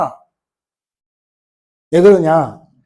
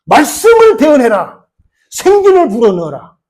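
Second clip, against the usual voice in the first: God's command; 6 semitones higher, 6 dB louder than usual. Acted. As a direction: pronounce the order with divine weight. God ordering Ezekiel to prophesy.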